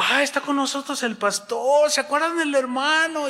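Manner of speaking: high-pitched mock delight; effusive welcome. Pastor welcoming back the man he expelled, effusive. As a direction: greet him grandly before the congregation.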